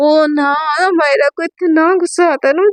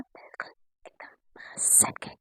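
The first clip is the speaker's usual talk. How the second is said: breathy whisper with no voice; whispered narration; 22 dB quieter than usual. A narrator's reading. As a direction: hush right down as they press close. Narrator describes intimate closeness.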